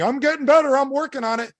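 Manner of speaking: sing-song mockery of a feeble excuse; mock self-excuser. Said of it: "Stop saying".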